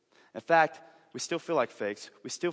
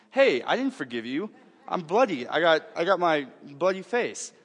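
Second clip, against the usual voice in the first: louder, higher, slowing on bloody face, mock outrage; indignant protest; 5 semitones higher, 6 dB louder than usual. Jay protesting he never forgave, in mock outrage. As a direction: play the punched guy, hammed-up indignant. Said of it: "Jay's sitting over here going".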